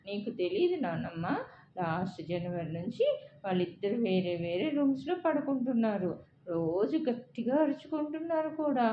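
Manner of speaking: lightly acted upset child, pitch climbing; building to tears. A narrator's reading. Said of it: "బావురుమన్నాడు Shubham".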